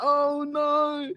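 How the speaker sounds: high mock panic voice; imitating Flex panicking. Mocking Flex fretting as Arsenal equalise, high panicky. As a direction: whimper it like a nervous fan. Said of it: "He's like".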